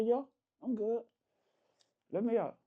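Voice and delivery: soft, quiet, deliberately calm; real calm. Retold or imitated. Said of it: "Real calm".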